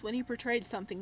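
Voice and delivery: ordinary delivery, nothing marked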